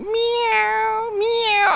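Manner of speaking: high, drawn-out cat meow. Retold or imitated. Man mimicking his cat asking for food, high drawn-out meow. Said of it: "He's like".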